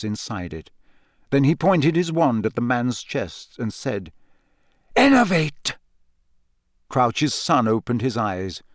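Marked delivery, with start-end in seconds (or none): 5.0-5.8 high, loud incantation; casting a spell. A narrator's reading. Headmaster pointing his wand at the man's chest, loud incantation. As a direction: call the magic word out forcefully.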